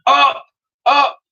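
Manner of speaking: high, loud barked commands; hollered. Acted. Lady Buckshot hollering up to the pilot, loud holler. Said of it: "hollered her instructions".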